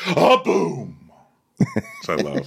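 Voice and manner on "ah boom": higher, punchy sung sting; imitating dramatic music. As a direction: hit it like a dramatic music cue. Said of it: "the music goes".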